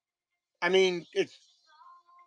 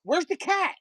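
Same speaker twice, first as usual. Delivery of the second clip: imitating his little son, much higher; a child asking, voiced high; son's voice; 11 semitones higher than usual. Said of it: "and he was like".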